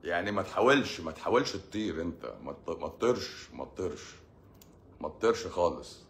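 soft, low pleading; gentle pleading. Real, not acted. Comedian begging an angry man softly. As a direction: beg him quietly not to jump. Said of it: "pleading advice to listener".

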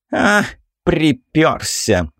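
thin, affected, drawn-out; mincing voice. Acted. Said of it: "тонкий жеманный голосок".

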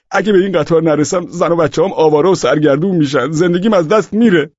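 high, loud frantic appeal; desperate pleading. A narrator's reading. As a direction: plead as if your livelihood depends on it. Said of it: "به افسر نگهبان التماس کردم".

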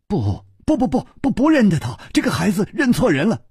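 flustered, high stammered denial; rushing to deny. A narrator's reading. Guilty couple cutting in on the boy, hurried. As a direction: blurt it out, tripping over the repeated no. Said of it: "两个人抢着说".

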